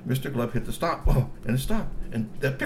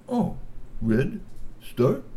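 high puzzled troll voice; 8 semitones higher, much slower than usual. A narrator's reading. Mister Glove learns the button.

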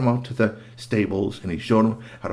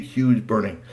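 usual speaking voice

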